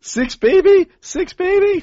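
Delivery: squeaky high hype chant. Acted. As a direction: sing it out high and cocky. Hype chant about six.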